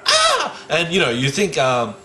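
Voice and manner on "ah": short, very high shriek; startled yelp. Retold or imitated. Sister surprised on the toilet, startled high yelp. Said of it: "and I hear a".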